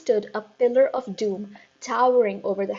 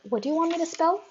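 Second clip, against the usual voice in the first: put-on much higher, puzzled boy; boy's voice; 8 semitones higher, much faster than usual. A narrator's reading. Eric puzzled by the headmistress, higher voice. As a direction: ask it like a confused schoolboy. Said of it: "Eric said".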